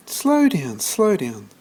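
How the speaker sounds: put-on high, old lady's voice; calm him down like a fussing old granny; soothing grandmother. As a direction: grandmother calming breathless George, high and fussing. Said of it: "said George's grandmother".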